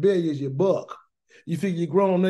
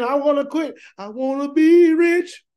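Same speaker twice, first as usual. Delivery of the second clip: slow, high, louder mock whine; whiny complaint; 5 semitones higher, 5 dB louder than usual. Preacher mocking people who complain to Yah, whining slowly. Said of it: "They complain".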